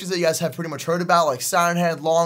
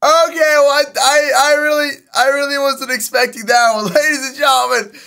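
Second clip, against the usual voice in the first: loud, high, still shaken and laughing; buzzing after a jump scare; breathless excitement; 9 semitones higher, 9 dB louder than usual. Real, not acted. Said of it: "My heart's pumping".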